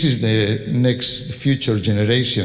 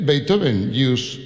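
ordinary delivery

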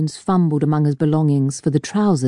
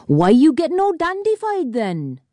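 much higher singsong Welsh jeer; mocking Welshman; 12 semitones higher than usual. A narrator's reading. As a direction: tease him in a high Welsh lilt. Welsh miner mocks.

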